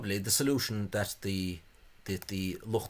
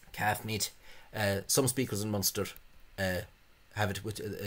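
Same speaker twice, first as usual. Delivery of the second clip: ordinary delivery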